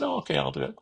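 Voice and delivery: squeaky high mock agreement; mock high okay. Young salesman meekly agreeing to his mentor, high-pitched.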